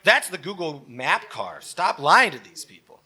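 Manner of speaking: usual speaking voice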